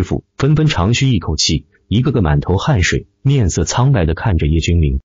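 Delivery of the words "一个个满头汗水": usual speaking voice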